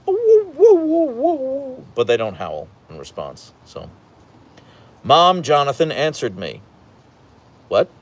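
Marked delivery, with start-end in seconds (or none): 0.1-1.8 high mock husky woo-wooing, loud then fading. Imitating Leia the husky's woo-woo, high and fading. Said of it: "Leia especially is like".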